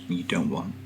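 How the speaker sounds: normal talking voice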